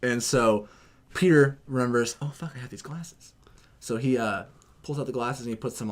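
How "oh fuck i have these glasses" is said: unvoiced sudden realization; whispered aside to himself. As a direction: breathe it out secretly. Retold thought, whispered.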